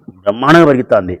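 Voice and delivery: ordinary delivery, nothing marked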